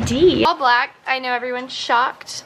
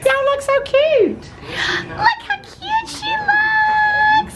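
much higher, slow, half laughing, half crying; laughing gush. Real, not acted. Gushing over a friend's outfit, laughing gush. Complimenting friends dressed for dinner.

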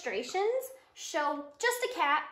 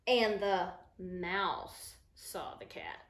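slow, lower, ominous read; spooky storytelling; 5 semitones lower, much slower than usual. Acted. Teacher reading the scary mouse page, slow and ominous. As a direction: make the line creep for the kids. Mouse sees cat as monster.

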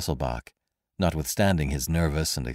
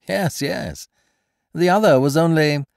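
airy, high, amused gentleman thief; breezy, toying with his victim; mocking stranger; 9 semitones higher, 6 dB louder than usual. A narrator's reading.

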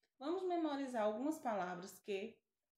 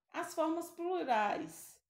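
ordinary talk, nothing marked

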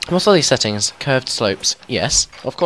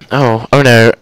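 much louder, sudden dismay; alarmed outburst; 3 semitones higher, 13 dB louder than usual. Real, not acted. Player realising the park has no exit, loud alarm. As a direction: blurt it out loudly in alarm.

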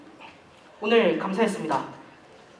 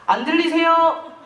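raised, drawn-out call to someone unresponsive; 5 semitones higher, 5 dB louder, slower than usual. Acted. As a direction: call louder and higher, stretching the words. Calling to someone who ignores them.

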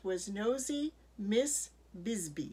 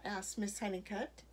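ordinary delivery, nothing marked

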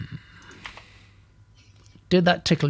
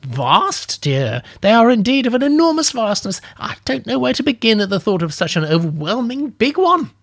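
put-on giddy old lady, higher and louder; tingling old lady; 8 semitones higher, 4 dB louder than usual. Old lady dreaming of something vast, giddy and higher. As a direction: marvel at something enormous. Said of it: "tingling sensation in her voice".

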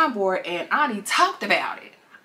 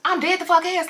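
higher, slow, offended insistence; insistent fiance; 9 semitones higher than usual. Retold or imitated. Fiance insists to Ani, high offended tone. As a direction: swear he means it. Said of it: "And then like".

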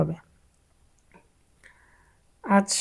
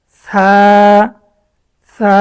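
loud, clear drill for students; loud letter recitation; 10 dB louder, much slower than usual. Shown as a demonstration. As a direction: belt out the letter name. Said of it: "তখন এটা হয়ে গেছে". (জোরে জোরে উচ্চারণ)

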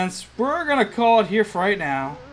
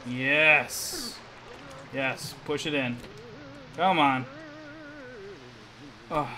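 usual speaking voice

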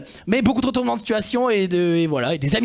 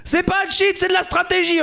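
indignant; 11 semitones higher than usual. Real, not acted. Defends himself in banter.

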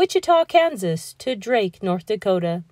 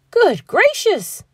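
high, drawn-out exclamation; slow, shocked outburst; astonished gasp; 9 semitones higher, 4 dB louder than usual. A narrator's reading. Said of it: "gasped Maisie".

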